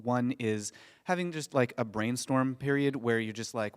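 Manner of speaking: ordinary talk, nothing marked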